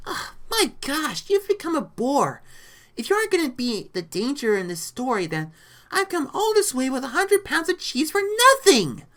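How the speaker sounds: high-pitched, exasperated complaint; grumbling. A narrator's reading. Rita fed up with a boring villain, high grumbling. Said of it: "Rita grumbled".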